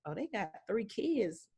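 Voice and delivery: usual speaking voice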